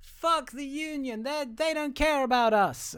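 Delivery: high, slow, drawn-out grumbling; mock disgruntled crowd. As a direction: complain like a sulky crowd, high-pitched. Acts out disgruntled Southern crowd.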